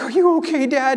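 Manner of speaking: high-pitched imitation of an upset child; mild mock distress, voice lifted high; tearful daughter. Daughter checking on her fallen dad, imitated tearful worry. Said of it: "And she's crying".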